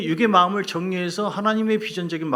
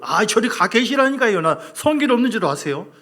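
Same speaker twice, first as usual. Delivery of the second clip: loud, higher, heated outburst; angry retort; 8 semitones higher, 8 dB louder than usual. Pretend. Preacher acting out Joseph refusing God, shouting angrily. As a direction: snap back in hot temper. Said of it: "이렇게 할 수 있잖아요".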